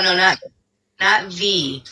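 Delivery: ordinary talk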